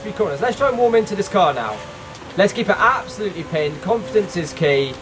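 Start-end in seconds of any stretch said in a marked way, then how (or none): none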